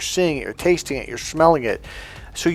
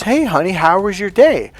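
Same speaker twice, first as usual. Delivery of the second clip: higher, sing-song greeting as a wife; wife, imitated; 5 semitones higher, 3 dB louder than usual. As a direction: play a sweetly inquiring partner. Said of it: "your wife or girlfriend goes".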